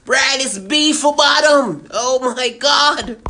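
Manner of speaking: slow, loud exasperated correction; fed-up dad over-enunciating; exasperated father. Acted. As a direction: spell it out to a dim son, slowly.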